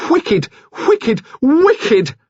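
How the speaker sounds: very high cries, climbing each time; growing shrill. A narrator's reading. Nurse cries out in passion, growing shrill. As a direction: repeat it shriller and shriller. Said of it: "She heard herself grow shrill".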